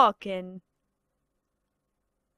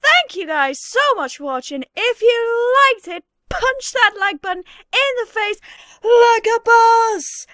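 much louder, higher shouting outro; loud Irish outro; 9 semitones higher, 8 dB louder than usual. Retold or imitated. Irish YouTuber yelling the sign-off, loud. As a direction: bellow the sign-off. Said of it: "a really loud video".

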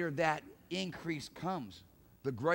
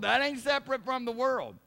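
usual speaking voice

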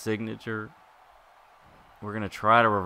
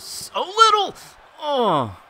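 frantic yell; 8 dB louder, slower than usual. Real, not acted. Health bar nearly gone.

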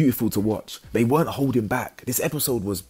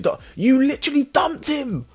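very high, squealed telling-off; exasperated outburst; 3 dB louder than usual. Acted. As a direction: berate in disbelief, pitch way up. Outburst at the one crying.

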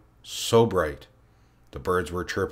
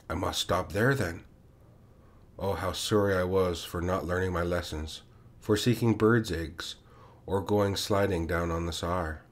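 usual speaking voice

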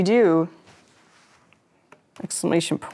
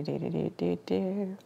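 usual speaking voice

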